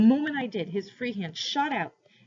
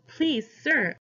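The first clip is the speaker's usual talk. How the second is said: higher-pitched anxious plea; begging; 6 semitones higher, 4 dB louder than usual. A narrator's reading. Boy pleading with his captor, anxious begging. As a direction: beg in mild panic. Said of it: "I begged, trembling with panic".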